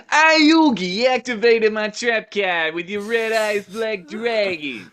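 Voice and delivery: loud, high New York Joey voice; brash New York shout; New York accent. Retold or imitated. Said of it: "my Joey impression".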